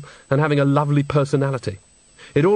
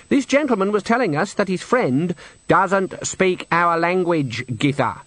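raised, pointedly polite explanation; pointed correction; 6 semitones higher than usual. Acted. Granny corrects Nanny before the men, pointedly polite. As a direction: explain it as a warning to hush.

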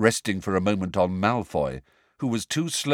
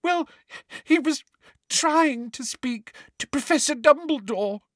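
gulping. Acted. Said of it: "gulped Hermione into her hands".

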